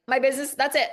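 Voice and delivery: a touch of retold alarm; retold panic. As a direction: replay the moment of dread. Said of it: "had this whole freak out over it".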